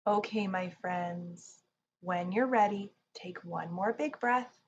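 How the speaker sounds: ordinary delivery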